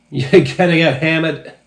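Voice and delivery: put-on half-finished Down East accent, cut off with a laugh; Down East accent retry. Reviewer retries the line in a Down East accent. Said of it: "Let me try it again".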